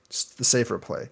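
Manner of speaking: normal talking voice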